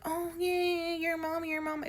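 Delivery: high, soft mimicked taunt; mock teasing. Mimicking people teasing about mom.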